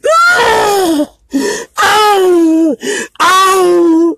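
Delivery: real crying, wailing very high; wailing sobs. Real, not acted. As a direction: let it break into a raw wail. Wails after telling life story.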